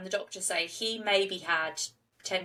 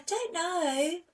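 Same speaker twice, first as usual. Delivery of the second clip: much higher, tiny meek little voice; how she talks; 9 semitones higher, much slower than usual. Retold or imitated. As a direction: play small and innocent, hiding behind a high mumble. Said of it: "Like, that's how she talks".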